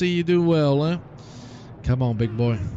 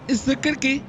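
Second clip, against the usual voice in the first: sing-song name call; 9 semitones higher than usual. Real, not acted. Calling out friend arriving in chat.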